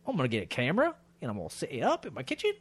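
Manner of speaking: a frustrated husband hatching a silly plan, rising to a squeak; mock dad plan. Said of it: "you're like".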